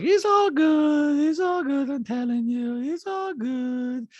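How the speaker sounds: high, drawn-out, detached reassurance; Diana. Retold or imitated. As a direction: insist eerily with no inflection. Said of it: "she's like".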